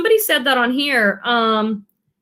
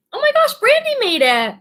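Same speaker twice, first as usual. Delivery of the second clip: high delighted squeal of a greeting; light up as a friend arrives; excited; 11 semitones higher than usual. Real, not acted. Greeting a viewer who just joined, thrilled and high.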